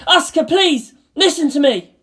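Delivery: loud urgent plea; pleading shout. Acted. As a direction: beg her to listen.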